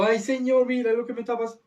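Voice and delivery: high, hammed-up wail; mocking imitation of a weeping martyr; mock crying. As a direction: cry out to God in fake tears.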